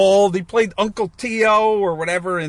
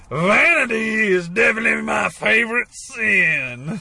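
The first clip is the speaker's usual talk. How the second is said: Al Pacino as the devil; 4 semitones higher than usual. Retold or imitated. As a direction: savour it like a smug devil. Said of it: "Al Pacino being like".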